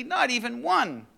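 high, mock-mournful storybook voice; hammed-up disappointment; sad. A narrator's reading. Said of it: "said Toad sadly".

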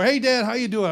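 high, loud bright hello to dad; cheery greeting. Retold or imitated.

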